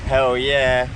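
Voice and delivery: loud, thrilled exclaiming; excited cheer. Real, not acted. Vlogger wanting the drone up, loud and excited. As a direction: call it out, pumped up.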